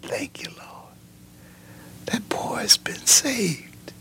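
hushed whisper of thanks to the Lord, slow; whispered prayer. Retold or imitated. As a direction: breathe it out like a father in awe. Father's thanks after his son's conversion.

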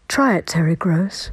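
low airy whisper; breathy voice. Shown as a demonstration. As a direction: breathe the words out like a sigh. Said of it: "It's kind of sighing".

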